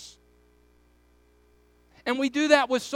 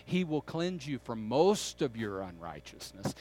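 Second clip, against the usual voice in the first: low-voiced line with "most" picked out and lifted; stress on MOST; 10 semitones lower, 6 dB quieter than usual. Shown as a demonstration. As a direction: lean on "most" as the wrong word. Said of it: "or the word most".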